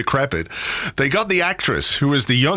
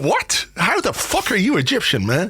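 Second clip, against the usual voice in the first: high-pitched mock incredulity; exaggerated outraged disbelief, voice shooting up; acted bafflement; 7 semitones higher than usual. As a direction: play the flabbergasted viewer. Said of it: "And you're like".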